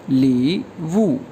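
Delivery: ली वू stretched very slowly; model pronunciation. Shown as a demonstration. Teacher modelling the word ली वू, very slow. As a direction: say ली वू slowly, syllable by syllable. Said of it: "Chinese में कहा जाता है".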